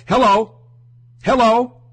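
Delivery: sarcastic hello, is anyone there; mock hello. Host mocking listeners who miss the point, sarcastic. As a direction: call out in disbelief.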